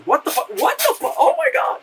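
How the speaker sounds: rising high, panicked disbelief; shocked realization. Retold or imitated. Girl wakes up kissing her friend, shrieking in shock. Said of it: "She goes".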